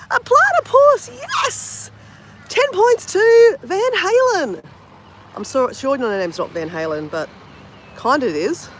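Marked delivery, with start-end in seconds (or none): 0.1-4.6 much higher, much louder, slow celebrating; game-show host delight; thrilled cheer. Real, not acted. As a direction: crown the winner with glee. Viewer guessed right.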